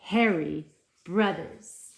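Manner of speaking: ordinary talk, nothing marked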